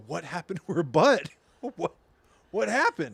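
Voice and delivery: exaggerated incredulous questioning; baffled reaction. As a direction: play up puzzled alarm, pitch climbing. Acts out baffled reaction to the phrase.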